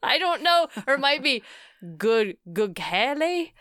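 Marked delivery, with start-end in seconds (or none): 2.0-3.5 Gughele stretched in a mock Italian lilt; fake Italian accent. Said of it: "a fake Italian accent on it".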